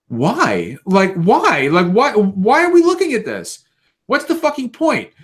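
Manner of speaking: building incredulous rant; exasperated outburst. Retold or imitated. Gamer ranting at a pointless butt shot, rising exasperation. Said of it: "And I'm like".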